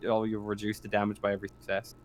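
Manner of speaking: ordinary talk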